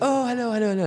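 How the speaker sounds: high excited hellos; delighted greeting. Retold or imitated. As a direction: fans thrilled to be greeted. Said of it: "everyone feels like".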